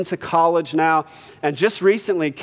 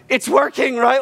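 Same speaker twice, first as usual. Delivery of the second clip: excited; 7 semitones higher than usual. Retold or imitated. Thrilled church moment retold.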